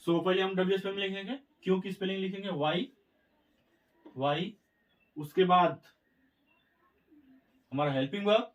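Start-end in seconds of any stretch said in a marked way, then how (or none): none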